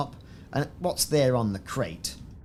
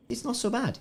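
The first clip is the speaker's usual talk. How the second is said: squeaked up high, much higher; 13 semitones higher than usual. Acted. Streamer judging the green ship paint, high squeak. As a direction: pipe it up in a tiny high voice.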